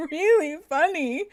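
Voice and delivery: high, laughing so hard it turns to crying; squeaking through tears of laughter. Real, not acted. To the chat, remembering Aaron and the old phrase, laughing to tears.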